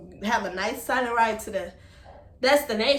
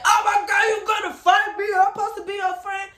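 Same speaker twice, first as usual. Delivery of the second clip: much higher and louder, indignant yelling; shocked friend hammed up in a loud, high cry; mock outraged friend; 10 semitones higher, 5 dB louder than usual. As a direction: play the offended friend shouting back. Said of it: "And then she said".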